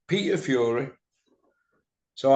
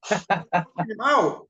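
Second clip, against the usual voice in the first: ordinary talk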